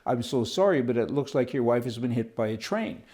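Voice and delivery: ordinary talk, nothing marked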